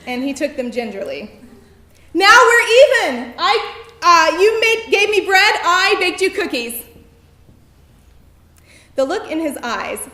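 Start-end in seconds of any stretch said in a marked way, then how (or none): 2.1-6.8 high, loud, tripping over words; flustered fan yelling to her idol; shouting over music. Retold or imitated. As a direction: blurt it out at a yell, flustered. Still shouting to [name] at concert.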